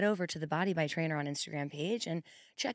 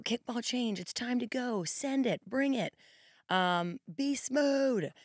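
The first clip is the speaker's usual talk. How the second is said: much higher, pumped-up coach call; hype cheer; 6 semitones higher than usual. Pretend. Trainer playing her imagined hotkey hype quote, high and pumped. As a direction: hype up the class like a recorded cheer. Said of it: "I'm not supposed to be doing that".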